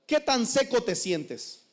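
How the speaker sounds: ordinary delivery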